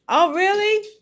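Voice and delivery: high surprised oh really; sudden pitch jump of surprise. Real, not acted. Reacting to a comment.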